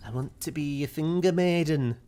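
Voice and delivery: playful high character voice; put-on wishful character. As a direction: pipe up with a mock-earnest wish. Acts out Steven's wish.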